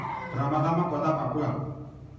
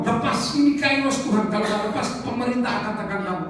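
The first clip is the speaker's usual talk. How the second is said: high, louder imitation of an insistent intercessor; urgent urging; 5 semitones higher, 4 dB louder than usual. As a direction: press the warning in a raised voice. Pendoa lain mendesak dengan peringatan. (mendesak dengan suara tinggi)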